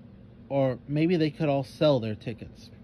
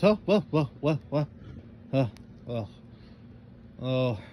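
startled and confused. A narrator's reading. DJ awakened by pounding on the desk, startled and confused. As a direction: jolt awake, then peter out. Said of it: "startled and confused".

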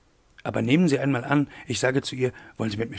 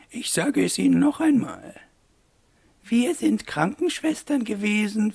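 high-pitched put-on lady's voice; reassuring mademoiselle; 9 semitones higher than usual. A narrator's reading. Mademoiselles tell Wolf they were nurses, high reassuring lady voice. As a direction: reassure him in a high, ladylike character voice.

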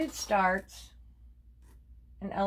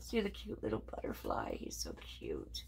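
cooing whisper; 11 semitones lower, 12 dB quieter than usual. Real, not acted. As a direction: whisper it like it's adorable. Cooing over a butterfly card.

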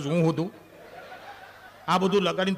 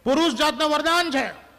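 emphatic proclamation; 8 semitones higher, 6 dB louder than usual. Acted. Comic declaration to audience.

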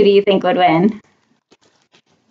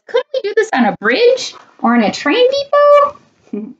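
high, anxious character plea; pleading train; 11 semitones higher than usual. A narrator's reading. Train on the tightrope wants a bridge, high plea. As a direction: beg in a pitched-up train voice.

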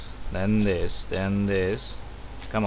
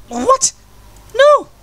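much higher and louder, real dismay; shocked shriek; 8 dB louder than usual. Real, not acted. Gamer shrieking as the puzzle collapses again. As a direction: yelp it as everything falls apart.

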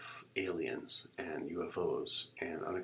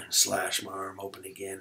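ordinary delivery

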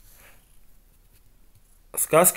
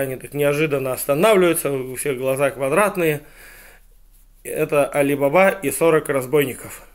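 plain reading voice

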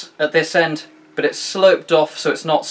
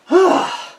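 effortful sigh; 5 dB louder than usual. Real, not acted. Relief after finishing the cut.

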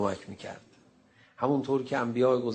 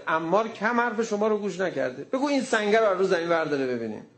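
mocking challenge; 7 semitones higher, 3 dB louder than usual.

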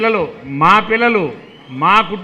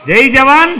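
louder this time, lead the crowd; slogan shouted louder on request; 3 semitones higher, 5 dB louder than usual. Shown as a demonstration. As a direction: raise the chant, call it out loud. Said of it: "ఇంకా గట్టిగా".

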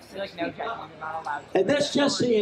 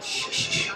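softer repeated shushes; hushing shh; 6 dB quieter than usual. Real, not acted. Speaker quieting the room before a joke, hushing. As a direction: shush the crowd in short bursts.

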